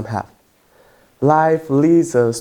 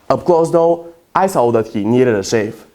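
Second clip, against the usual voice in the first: usual speaking voice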